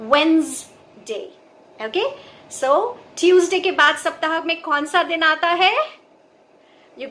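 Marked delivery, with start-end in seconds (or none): 0.1-1.2 Wednesday with a clear z in the middle, wenz-day; z sound. Shown as a demonstration. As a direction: let a z buzz in Wednesday. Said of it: "ये थोड़ी सी ज sound आ जाती है बीच में".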